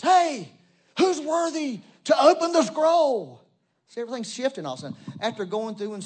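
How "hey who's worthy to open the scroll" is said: booming angel call, much higher and louder; strong angel. Acted. Strong angel calling out across heaven, booming cry. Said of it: "This strong angel says".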